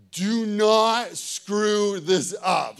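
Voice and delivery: loud, very slow, word by word; emphatic self-warning. Retold or imitated. Player ahead in ping pong warns himself, loud and slow. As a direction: hammer out each word as a warning.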